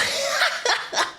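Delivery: high breathy laugh; real laugh; burst of laughter. Real, not acted. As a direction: laugh out at the memory. Laughs at child's question.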